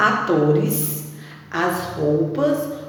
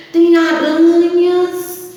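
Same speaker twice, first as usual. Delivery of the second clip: dramatic spooky exclaim; 10 semitones higher, 8 dB louder, much slower than usual. Acted. Spooky picture, dramatic reveal.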